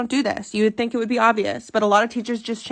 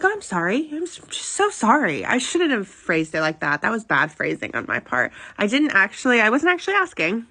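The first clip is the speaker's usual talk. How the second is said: a little sassy; 2 semitones higher than usual. Shown as a demonstration. Teacher to an off-task student, sassy fake apology. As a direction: apologize sweetly while making a point. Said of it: "It's a little sassy".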